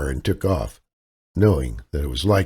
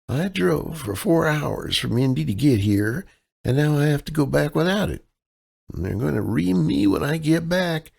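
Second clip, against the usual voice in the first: higher, helpless Midwestern damsel voice; damsel in distress; 8 semitones higher than usual. Acted. Kaylee sweet-talks the clerk as a damsel in distress. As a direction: play the sweet, lost out-of-towner, pitched up. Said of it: "her damsel in distress persona".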